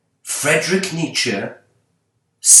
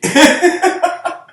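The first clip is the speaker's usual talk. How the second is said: sudden high, loud laugh; 7 dB louder than usual. Real, not acted. Laughing at his own line about eternity, high burst of laughter.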